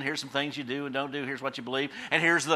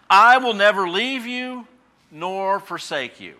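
slow, emphatic, the first words louder; solemn slow promise; 5 semitones higher, 9 dB louder, slower than usual. Acted. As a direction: proclaim it slowly, each word weighty. Preacher quoting God's promise.

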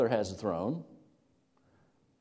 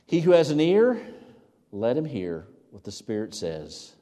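preaching call; 2 semitones higher, 6 dB louder than usual. Acted. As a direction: call out to every listener. Closing scripture call.

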